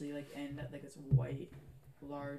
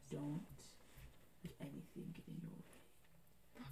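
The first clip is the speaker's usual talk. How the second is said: slow, soft, near-whispered warning; hushed command; 10 dB quieter, slower than usual. Acted. Mysterious voice urges its champion onward, hushed.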